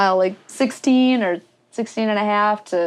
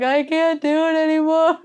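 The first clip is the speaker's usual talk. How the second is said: high, whiny mock wail; hammed-up tearful wail; bawling; 8 semitones higher than usual. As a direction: imitate yourself bawling, pitched way up. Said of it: "I just start bawling".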